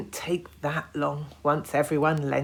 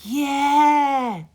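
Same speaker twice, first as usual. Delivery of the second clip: loud high eager yeah; chorused yeah; 12 semitones higher, 10 dB louder than usual. A narrator's reading. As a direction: cheer together. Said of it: "chorused all the big boy cousins".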